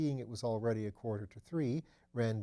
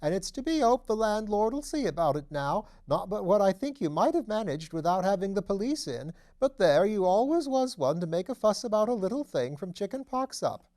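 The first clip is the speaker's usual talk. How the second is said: put-on much higher, louder piping old lady; high piping deaf voice; 9 semitones higher, 8 dB louder than usual. A narrator's reading. Deaf old mother scolding her son, high and piping. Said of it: "in the high, piping voice peculiar to the deaf".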